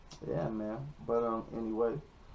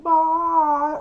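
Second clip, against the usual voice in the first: very high, loud, drawn-out mock goodbye; mimicked cheery woman; 10 dB louder than usual. Drunk passenger waving at the cops, mimicked high squeal. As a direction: sing it out in an exaggerated falsetto.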